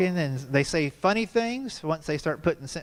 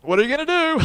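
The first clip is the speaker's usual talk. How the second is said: put-on much higher and louder defiant little boy; cocky child squaring up to dad; challenging kid voice; 12 semitones higher, 7 dB louder, faster than usual. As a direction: throw it up like a dare. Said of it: "he looks at me and goes".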